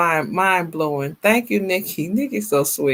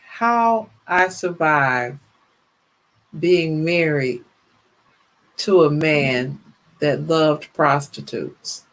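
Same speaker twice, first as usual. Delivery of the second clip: slow, drawn-out title announcement; slow title read; slower than usual. Shown as a demonstration. Host retrying her story title, slow and deliberate. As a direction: lay the title out slowly, word by word. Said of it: "retrying the story title".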